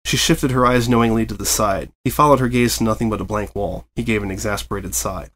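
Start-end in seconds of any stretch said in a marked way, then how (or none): none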